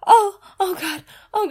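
ordinary talk, nothing marked

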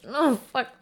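ordinary talk, nothing marked

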